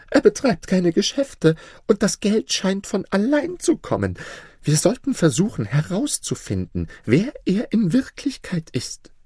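high-pitched, fretful vizier; whining scheming vizier. Acted. Vizier urges king to investigate Maruf, whining. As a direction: press the suspicion in a high voice.

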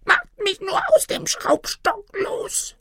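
very high, thin squeaky plea; whimpering tiny man. A narrator's reading. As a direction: whine to be freed, squeaking like a gnome. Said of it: "winselte das winzige". (winselndes Männlein, hoch und piepsig)

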